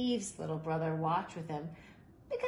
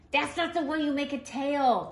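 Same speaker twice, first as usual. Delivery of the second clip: higher, loud scornful put-down; big boy scorning Steve's kite tail; 9 semitones higher, 7 dB louder than usual. Acted. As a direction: sneer it at the little kid. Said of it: "the big boy said scornfully".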